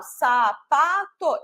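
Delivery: "sapato" stretched slowly, sa-pa-to; slow syllable demo. Shown as a demonstration. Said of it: "ponho o tô no final". ("sapato" bem devagar, sílaba por sílaba)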